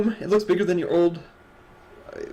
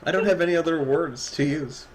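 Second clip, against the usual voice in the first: normal talking voice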